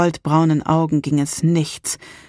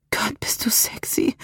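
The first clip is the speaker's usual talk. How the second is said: whispered, higher and softer, panting; breathless whisper; 6 semitones higher, 5 dB quieter than usual. Acted. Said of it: "keuchte sie".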